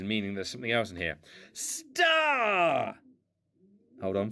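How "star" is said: loud, high call across the house; shouting. Real, not acted. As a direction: yell the name out. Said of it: "I've been doing a lot of shouting".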